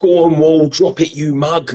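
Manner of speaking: high-pitched, drawn-out London geezer cheer; hammed-up London geezer impersonation; Danny Dyer. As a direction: egg it on like a cockney game-show host. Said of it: "and also it's Danny Dyer".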